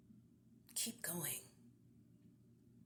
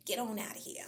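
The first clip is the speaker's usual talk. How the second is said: louder, brushing them off with a wave; dismissive; 4 semitones higher, 6 dB louder than usual. Acted. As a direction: shoo them away, half laughing. Dismissing unhelpful people.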